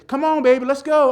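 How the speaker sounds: high, loud mock cheering; sugary baby-talk cheer. Shown as a demonstration. Speaker showing how mothers might cheer, hammed-up high call. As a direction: demonstrate a gushing parent calling out. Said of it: "you know where you talk like this".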